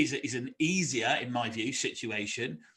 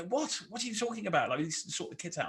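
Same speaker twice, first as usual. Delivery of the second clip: much higher, incredulous what; baffled; 6 semitones higher, 4 dB quieter than usual. Retold or imitated. [name] baffled at Paul's request, higher incredulous. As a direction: sound completely baffled. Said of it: "I was like".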